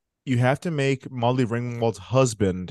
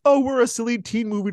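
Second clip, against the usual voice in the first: put-on silly teen movie; 7 semitones higher, faster than usual. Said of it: "the acting goes from".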